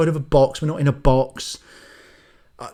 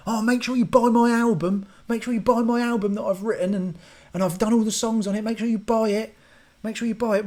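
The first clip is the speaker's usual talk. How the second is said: much higher, pushy self-promoter voice; mock album plug; 9 semitones higher than usual. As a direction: ham up a needy musician begging sales. Mocking a musician plugging album.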